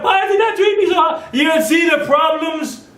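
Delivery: high, ringing shout at the crowd; shouting preacher. Real, not acted. Preacher shouts the point home to his congregation. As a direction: cry it out in full voice.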